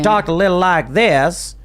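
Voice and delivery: put-on lightly drawled Southern accent. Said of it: "she put on a Southern drawl".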